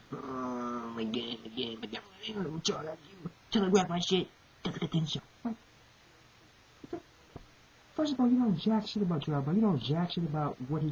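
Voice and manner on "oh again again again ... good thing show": a calm villain looking down on him; mock villain. Voicing the villain's reply.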